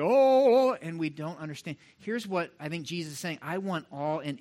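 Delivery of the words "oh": high, loud pompous "Oh"; a grand, ritual exclamation; mock solemn prayer.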